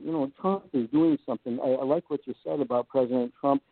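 ordinary talk

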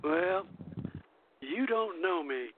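ordinary delivery

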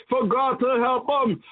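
ordinary talk, nothing marked

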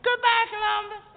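high, loud farewell squeal; excited screaming girls. Retold or imitated. Girls at the pier seeing him off, high squeal. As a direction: squeal goodbye like a fan. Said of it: "They're excited and screaming".

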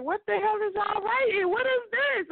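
high, surprised outburst; playing the stunned friend reading; mock shocked friend. Teen friend reading the notebook, shocked. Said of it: "He was like".

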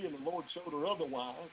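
normal talking voice

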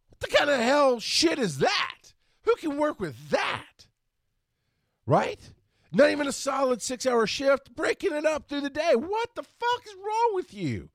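high, loud exasperated shouting; furious rant. Real, not acted. Ranting about split shifts, high and loud. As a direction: yell it in disbelief at the schedule.

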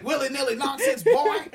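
laughing southern twang; mock southern accent. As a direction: ham up a southern preacher accent. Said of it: "Put on a southern accent".

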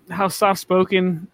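ordinary talk, nothing marked

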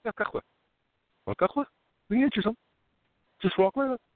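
high teasing quote; mock incredulity. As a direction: play the local offering coffee. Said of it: "And I was like".

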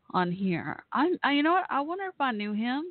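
ordinary talk, nothing marked

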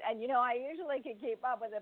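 usual speaking voice

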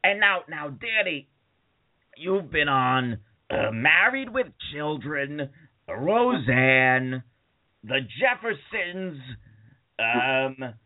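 lightly imitated interviewer, drawn out; Gilbert and Frank Santopadre. Hosts listing the guest's credits, slow stretched delivery. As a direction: slowly reel off credits, each title stretched. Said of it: "Gilbert and Frank Santopadre, they were like".

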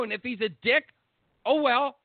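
ordinary talk

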